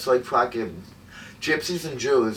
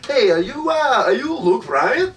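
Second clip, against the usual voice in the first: much higher, louder put-on woman's voice; 12 semitones higher, 5 dB louder than usual. Mocking a fan who mistakes you for [name], high woman's voice. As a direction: play a gushing starstruck woman. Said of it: "some racist woman is gonna go up to you and say".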